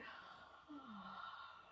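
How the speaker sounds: long breathy sigh out; dramatic sigh. Shown as a demonstration. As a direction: let it all go in a big sigh. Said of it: "Let go with a big sigh".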